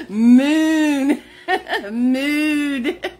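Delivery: "Moon", "mood" with stretched oo through real laughter; laughing cow moo. Real, not acted. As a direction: laugh while mooing out the oo. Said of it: "I don't know why I accentuate that double O".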